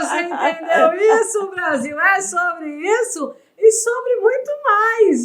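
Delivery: high, loud hype for the audience; thrilled on-air cheer; excited exclaiming. Real, not acted. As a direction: pump up the viewers like a TV host. Host hypes guest to audience.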